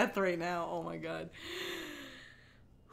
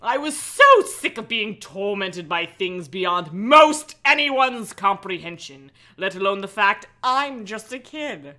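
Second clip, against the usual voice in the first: louder, earnest melodramatic read; dramatic story reading; 3 semitones lower, 6 dB louder than usual. A narrator's reading. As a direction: read it like angsty teen prose.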